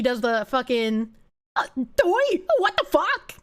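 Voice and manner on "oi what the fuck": higher, lightly voiced Peppermint outburst; a hint of a British oi, fired off; lightly voiced oi what the fuck; what the fuck impression. Shown as a demonstration. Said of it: "do my best what the fuck impression".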